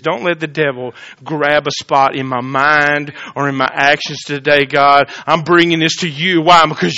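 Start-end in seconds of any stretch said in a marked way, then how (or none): none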